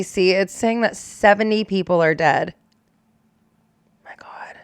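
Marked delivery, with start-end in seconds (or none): 4.1-4.6 breathless whisper of disbelief; whispered in horror; shocked whisper. Real, not acted. Reacting to seventy dead.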